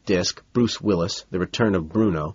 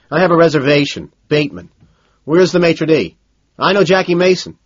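raised, louder, pushy customer voice; insistent demand; 6 semitones higher, 4 dB louder than usual. A narrator's reading. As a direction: demand a table, pitched up and forceful. Demanding a table as Bateman.

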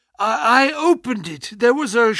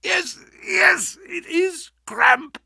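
weak dying man; 10 semitones higher, slower than usual. Acted.

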